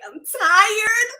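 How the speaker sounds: high, loud retold complaint; fed-up wail to the Lord; angry outburst at God. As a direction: cry it out, exasperated. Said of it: "I was angry. I was cussing out the Lord".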